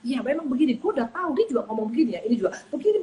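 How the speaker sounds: loud, high, egging-on agreement; fanning the flames, mock heated; heated gossip. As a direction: stir it up like a gossiping neighbour. Gossiping woman fanning the conflict.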